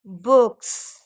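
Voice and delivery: बुक्स with a clear hissing s at the end; teacher demo. Shown as a demonstration. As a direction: stress the final s in बुक्स. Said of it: "sound क्या आ रहा है?". (बुक्स के अंत में स ज़ोर से)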